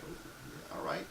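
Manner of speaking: unsure whispered okay; whispered reply. Retold or imitated. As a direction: whisper it, not quite convinced. Partner's hesitant reply, retold.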